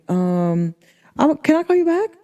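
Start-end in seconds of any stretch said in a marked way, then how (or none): 1.2-2.1 high-pitched, put-on politeness; mock polite excuse. Woman ducking out of a phone call, high mock-polite excuse. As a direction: make a high, airy excuse to hang up.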